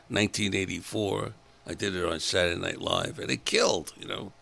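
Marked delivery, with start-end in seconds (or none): none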